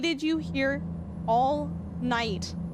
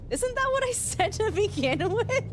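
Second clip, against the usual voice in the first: high, laughing disbelief; laughing protest; 10 semitones higher than usual. Real, not acted. Streamer laughs at chat over Cairo, high giggling protest. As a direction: laugh through the complaint.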